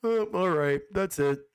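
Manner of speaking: mock-dull host wrapping up; bland host. Mocking a dull desk host closing a segment, bland. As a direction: imitate a boring host with nothing to add. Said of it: "Leaf is just the guy like".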